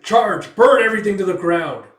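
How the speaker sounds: loud, high war cry; rallying shout of a commander; battle command. Acted. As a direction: roar the order to the troops. Commander orders the attack.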